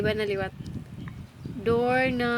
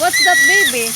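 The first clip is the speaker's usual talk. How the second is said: excited call; 7 semitones higher, 9 dB louder than usual. Real, not acted. Mom calling toddler's attention.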